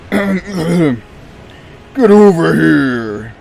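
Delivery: slow, drawn-out growling yell; Scorpion voice. Shown as a demonstration. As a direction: bellow it like Scorpion pulling you in. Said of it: "do the Scorpion voice better".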